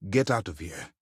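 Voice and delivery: ordinary talk, nothing marked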